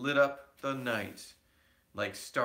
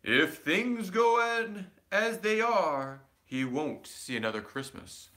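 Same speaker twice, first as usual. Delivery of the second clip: ominous spirit voice; 7 semitones higher, 5 dB louder than usual. A narrator's reading. Spirit's echoing warning.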